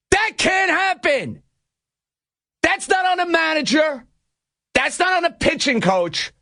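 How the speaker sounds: high, emphatic, exasperated outburst; exasperated scolding. Real, not acted.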